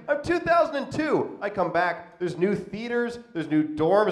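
usual speaking voice

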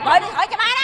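high, loud angry command; irritated order. Acted. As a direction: yell the order, out of patience. Irritated kidnapper orders a call. (quát ra lệnh, bực bội)

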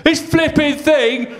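put-on high shouted drunken fury; drunk vending machine rage. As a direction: yell at the broken machine. Drunk man banging vending machine.

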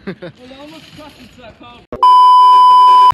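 very high shrieking laughter. Real, not acted. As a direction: howl with laughter. Friend laughing at mad ride.

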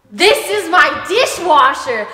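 loud, high, slow playful announcement; showy reveal. Acted. Host answering viewers who ask where it is, showy reveal. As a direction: present it grandly, stretching every word.

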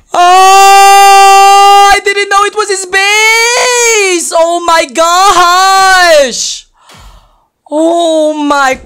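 loud, very slow crying wail; anguished wail. Real, not acted. Player regrets entering the base, anguished slow wail. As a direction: draw every word out as a wail. Wailing after losing.

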